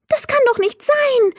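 can't believe it; disbelief. Acted.

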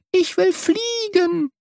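high, slow little chick's peep; piping chick. A narrator's reading. Newly hatched chick pipes its wish, high and slow.

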